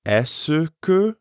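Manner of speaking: "Est-ce que" with every syllable clear, the "que" fully pronounced; careful full pronunciation. Shown as a demonstration. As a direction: spell out each sound of "Est-ce que". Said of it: "Notice the difference".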